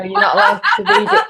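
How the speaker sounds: laughing. Real, not acted. Joke said laughing.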